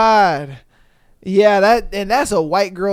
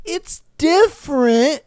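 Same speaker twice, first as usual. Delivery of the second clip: slow, higher, careful verdict; polite dodge; 7 semitones higher, slower than usual. Retold or imitated. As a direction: say it like a diplomatic food critic. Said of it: "you're trying somebody's food".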